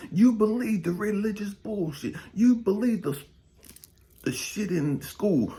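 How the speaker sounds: loud, high, rising to a full shout; preaching shout. Acted. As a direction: rail at them from the pulpit. Preacher rails against false promises.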